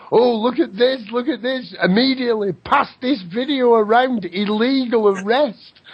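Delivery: high mocking call to look; lightly mimicked excited onlookers; mocking excited protesters. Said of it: "people down there telling".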